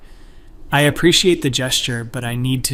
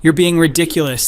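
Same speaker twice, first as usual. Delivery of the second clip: raised, louder upset protest; higher, louder upset voice; upset retort; 5 semitones higher, 7 dB louder than usual. A narrator's reading. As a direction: snap back at him, stung. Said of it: "Grace starts getting upset".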